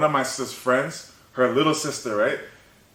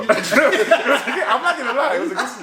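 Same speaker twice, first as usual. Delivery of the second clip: laughing; 9 semitones higher than usual. Real, not acted. Laughing about sleep.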